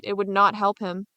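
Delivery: ordinary delivery, nothing marked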